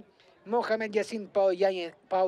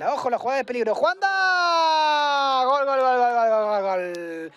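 long high shouted goal call; goal scream; 5 semitones higher, 4 dB louder than usual. Real, not acted. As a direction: belt out the goal. Second goal called.

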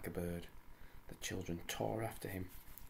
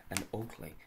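ordinary delivery, nothing marked

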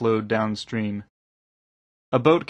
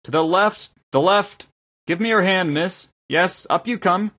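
raised, high-pitched instructions; calling out directions; 9 semitones higher, 5 dB louder than usual. A narrator's reading. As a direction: steer them in and help them aboard. Guiding a boat in, helping aboard.

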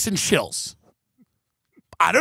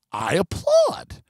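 ordinary talk

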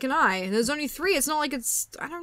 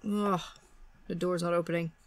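usual speaking voice

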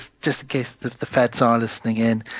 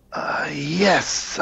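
breathy stoner; 3 semitones higher than usual. Retold or imitated. Imitating the stoner.

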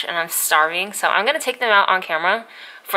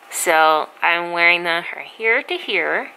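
talking with mouth stretched wide; fingers pulling lips apart; slower than usual. Real, not acted. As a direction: lips held back by fingers. Seen 0: Fingers hook mouth corners, pulling lips back to show braces.

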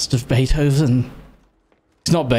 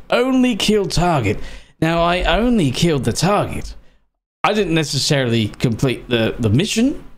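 usual speaking voice

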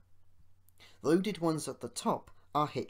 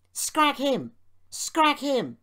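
high, loud mob yell; full-voiced high shout, falling at the end; 12 semitones higher, 10 dB louder, much slower than usual. A narrator's reading. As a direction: yell it like a gang out for revenge. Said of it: "they yelled".

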